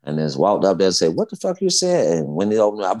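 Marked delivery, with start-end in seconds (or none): 1.2-2.2 higher, mock-menacing question; confrontational challenge. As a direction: replay squaring up to the heckler. Confronting the fan in the stands.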